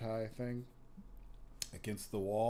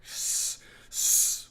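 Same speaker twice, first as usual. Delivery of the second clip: voiceless swan hiss, the second louder; imitated swan hiss; 7 dB louder than usual. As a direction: hiss through the teeth like an angry swan. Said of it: "The swan's hissing".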